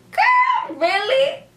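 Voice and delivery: high-pitched squeal of disbelief; exaggerated, high disbelief; incredulous. Real, not acted. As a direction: call her out in a shrieky high voice. Mock disbelief at Alex's claim.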